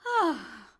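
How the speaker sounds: long airy sigh out; demo sigh. Shown as a demonstration. Vocal coach demonstrating a sigh to viewers, breathy. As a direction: let the breath go in a big sigh. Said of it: "everyone just do a sigh".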